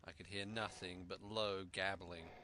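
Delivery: ordinary delivery, nothing marked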